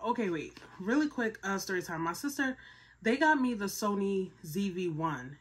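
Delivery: ordinary talk, nothing marked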